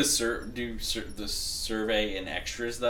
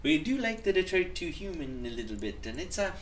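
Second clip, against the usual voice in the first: put-on Scottish accent, higher; hammed-up Scottish voice; Scottish accent for chat; 7 semitones higher than usual. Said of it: "for Scottish accent".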